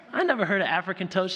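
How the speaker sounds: high, quick baffled protest; disbelieving kids, imitated. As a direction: protest like children who are totally baffled. Children's disbelief, retold.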